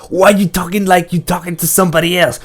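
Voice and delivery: loud, high, indignant character shouting; agitated protest. A narrator's reading. Detta orders Roland to quit that jive, agitated. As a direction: rail at him to quit it. Detta rails at being ignored.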